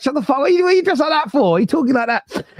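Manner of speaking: exasperated mock rant. Mocking fake-roadman middle-aged YouTubers.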